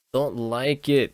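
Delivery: ordinary talk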